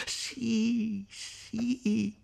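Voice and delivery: sì alti e affrettati; risposta precipitosa. Acted. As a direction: rispondi in fretta, ansiosa. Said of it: "rispose precipitosamente Lucia". (sì ansiosi e precipitosi)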